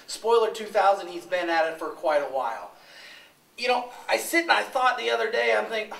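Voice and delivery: loud close mic promo; loud wrestler promo. Real, not acted.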